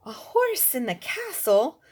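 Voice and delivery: loud, high shocked cry; a queen appalled at a horse indoors; queen's shocked scream. Acted. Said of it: "the queen screamed".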